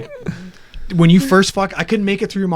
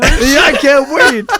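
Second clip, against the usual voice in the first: high, drawn-out laughing agreement; real giddy laughter; 8 semitones higher, 5 dB louder, much slower than usual. Real, not acted. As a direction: laugh through the words, stretched out. Excited laughter about coming game.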